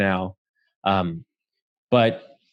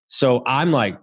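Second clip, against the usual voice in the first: ordinary talk, nothing marked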